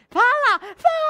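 high, loud shout of fala; shouted hype cue; excited shout. Real, not acted. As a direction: yell it like a game-show cue. Shouting to reveal the promo.

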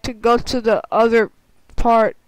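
normal talking voice

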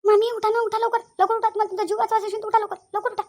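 loud, high urging; frantic warning to get moving at once; urgent alarm. Acted. Urging aunt up from danger.

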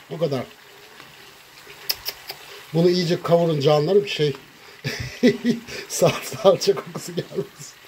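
usual speaking voice

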